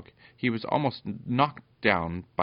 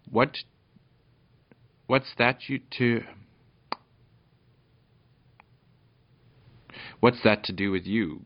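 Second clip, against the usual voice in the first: loud, gruff retort with a mild growl; growling dog; 3 semitones higher, 5 dB louder than usual. A narrator's reading. The goblin dog answers Peter back, lightly growled. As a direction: growl it lightly, as a narrator would. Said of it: "growled the goblin dog".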